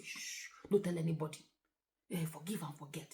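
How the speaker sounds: very soft, low secretive voice; mock hushing. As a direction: hush it up like a mother burying a secret. Mothers hushing their daughters.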